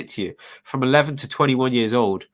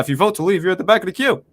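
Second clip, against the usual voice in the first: higher-pitched mimicry of a politician's accent; Obama impression; 7 semitones higher, faster than usual. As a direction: quote the president in his accent, pitched up. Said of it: "You did his accent?".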